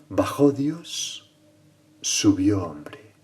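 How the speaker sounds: very slow, rising then falling halves; solemn maxim. Acted. Preacher quoting San Ambrosio's four words, very slow and weighty. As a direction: stretch each half out, lifting the first and lowering the second.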